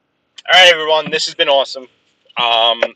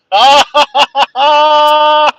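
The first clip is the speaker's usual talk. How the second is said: loud high burst of laughter; big laugh; 13 semitones higher, 8 dB louder than usual. Real, not acted. Friend joins at the end.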